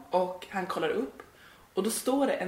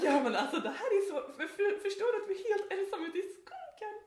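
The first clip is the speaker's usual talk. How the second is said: much higher and louder, laughing in real disbelief; 11 semitones higher, 9 dB louder than usual. Real, not acted. Telling her cohost about the stranger, laughing in real disbelief. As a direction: burst out laughing at how absurd it was.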